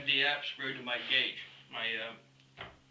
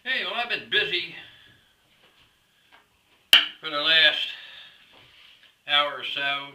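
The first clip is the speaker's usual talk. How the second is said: raised voice over machine noise; shouting over table saw; 7 dB louder than usual. Real, not acted. Talking over running saw noise.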